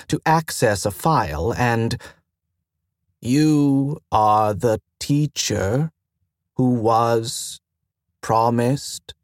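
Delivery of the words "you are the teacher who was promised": very slow, halting question, faint rise; slight query inflection. Acted. Station AI asking the pilot, very slow with a slight rise. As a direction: feel your way to the end. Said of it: "Slight query inflection there".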